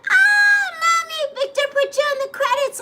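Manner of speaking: a high excited daughter voice, quoted. Retold or imitated. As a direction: squeal it like an excited kid. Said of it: "she's like".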